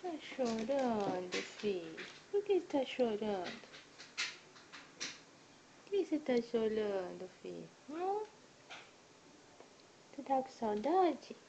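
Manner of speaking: hushed caring questions; tender comforting. Real, not acted. Comforting crying child.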